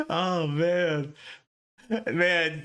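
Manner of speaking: ordinary delivery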